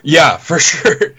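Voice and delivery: chuckles through a slow yeah, for sure; laughing. Real, not acted. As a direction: laugh it out, drawn out and amused. Laughing agreement about buying beer.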